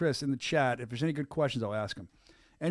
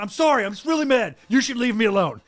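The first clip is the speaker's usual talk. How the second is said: loud apology; 7 semitones higher, 8 dB louder than usual. Retold or imitated. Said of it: "And then I went".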